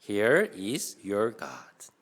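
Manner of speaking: loud, very slow English reading; slow read-along. Shown as a demonstration. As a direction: lead the group word by word, projecting. (천천히 크게 따라 읽히는 영어 낭독)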